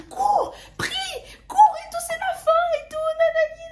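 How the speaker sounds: very high, loud laughing shriek; frantic yelling. Retold or imitated. Shrieking at her friend to run and pray. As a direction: scream it in mock panic. Retold yelling while running.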